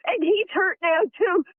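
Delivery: normal talking voice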